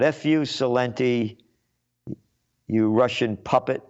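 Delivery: usual speaking voice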